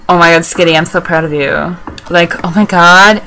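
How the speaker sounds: usual speaking voice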